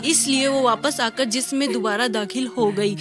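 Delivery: ordinary delivery, nothing marked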